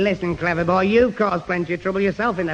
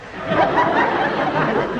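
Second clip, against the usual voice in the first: a much higher, squealing giggle. Real, not acted. [name] giggling at his own phone mix-up, high squealing giggle. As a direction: squeal with embarrassed laughter.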